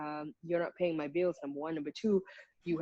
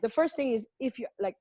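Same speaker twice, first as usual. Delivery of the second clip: usual speaking voice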